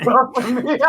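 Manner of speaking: laughing. Acted. Repeats Risu's line laughing.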